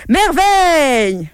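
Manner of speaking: drawn-out, high roar of wonder; a slow, exultant royal cry; roaring king. Acted. King roars at the blooming rose, drawn-out and high. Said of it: "ouvrit les bras et rugit".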